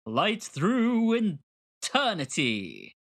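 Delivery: grand, slow trailer voice; epic announcer. Shown as a demonstration. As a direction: announce the title like a movie trailer. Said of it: "Felt like I needed to say that epically".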